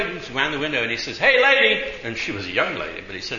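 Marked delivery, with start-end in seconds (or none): 1.2-1.8 higher, louder call to the next car. Retold or imitated. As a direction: hail a stranger from a car window. Calling from car window.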